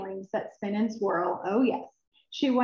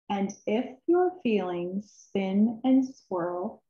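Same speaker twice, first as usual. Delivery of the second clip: usual speaking voice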